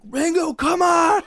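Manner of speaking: high, loud retold plea; yelling to a friend. Friends calling down the hole to him, yelling. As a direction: holler down, urging him up. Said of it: "'cause we're yelling".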